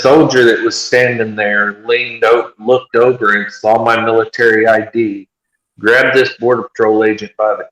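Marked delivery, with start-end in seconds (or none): none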